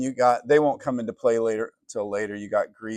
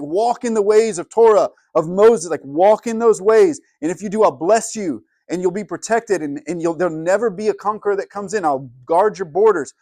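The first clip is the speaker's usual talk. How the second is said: higher, louder preaching as God; God's call; 7 semitones higher, 6 dB louder than usual. Acted. Preacher voicing God urging Israel to walk in his ways, loud and high. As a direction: proclaim it over them like God himself.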